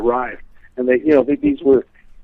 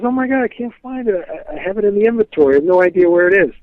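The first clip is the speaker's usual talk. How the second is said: flustered clerk; 7 semitones higher than usual. Retold or imitated. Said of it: "He says".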